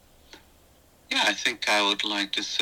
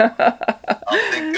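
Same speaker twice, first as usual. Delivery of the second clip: laughing through the words; high laugh breaking in; 9 dB louder than usual. Real, not acted. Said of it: "laughing restart".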